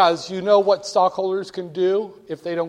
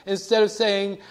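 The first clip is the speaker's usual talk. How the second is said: normal talking voice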